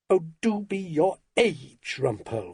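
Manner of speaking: slow, exasperated wifely rebuke; weary telling-off, falling on the name; exasperated scolding. A narrator's reading. Wife scolds, then slams phone down.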